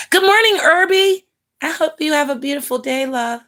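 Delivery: high sing-song hello; greeting a viewer on the live stream, high and bright; cheery greeting. Real, not acted. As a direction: sing out a good morning.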